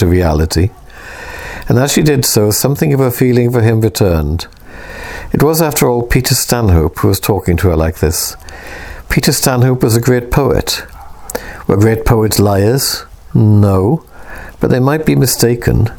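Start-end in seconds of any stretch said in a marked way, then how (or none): none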